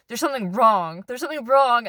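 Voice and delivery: insistent. Retold or imitated. Insisting to boyfriend about guy.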